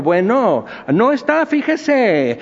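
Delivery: high, bright chant on "no está, fíjese"; phone voice in the workers' register. Retold or imitated. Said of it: "agarro otra vez el tono y contesto el teléfono".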